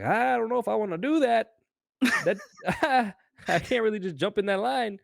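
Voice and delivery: high, loud mock protest; reluctant protest. Retelling his reluctant protest.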